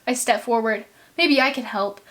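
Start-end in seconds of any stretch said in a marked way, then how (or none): none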